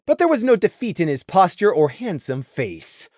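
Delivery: ordinary delivery, nothing marked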